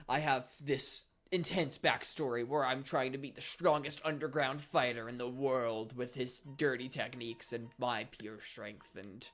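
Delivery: lightly voiced mock-dramatic fighter, slowing and trailing off; a hint of overblown seriousness, winding down slowly; mock intense backstory. Mocking other characters' intense backstories, fading slowly. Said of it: "some people it's like".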